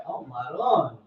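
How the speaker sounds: usual speaking voice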